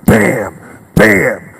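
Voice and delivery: loud, punched "bam"; abrupt loud bursts acting out the hit; sudden impact. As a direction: hit each bam hard like a collision. Boys suddenly slam into his legs.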